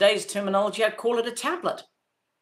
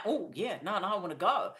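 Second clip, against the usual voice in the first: much softer retold child's reply; soft agreement; 8 dB quieter than usual. Child answering playmates' call to go, softly keen. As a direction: agree quietly, almost to yourself.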